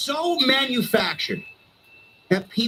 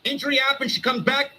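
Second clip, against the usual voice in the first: normal talking voice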